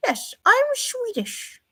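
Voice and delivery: high, slow mock-Swedish voice; hammed-up, badly faked Swedish accent; terrible Swedish accent. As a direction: do a bad Swedish impression, dragged out. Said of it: "your terrible impression".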